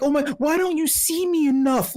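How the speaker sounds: mock whiny guest. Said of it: "Like, like".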